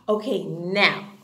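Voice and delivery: ordinary delivery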